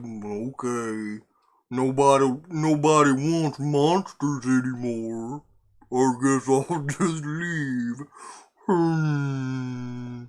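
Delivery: slow, hammed-up mopey monster voice; super bummed monster. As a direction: drag it out like a dejected monster, mock-crying at the end. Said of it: "like, super bummed about it".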